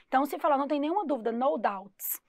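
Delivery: ordinary delivery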